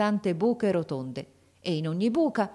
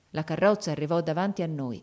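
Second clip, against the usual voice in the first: plain reading voice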